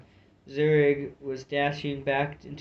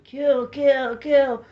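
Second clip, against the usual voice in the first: much higher, loud bellowed kill order; savage bellow; 11 semitones higher, 7 dB louder, much slower than usual. A narrator's reading. Gulu drives his warriors forward, bellowing charge. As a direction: bellow it at your warriors like a warlord.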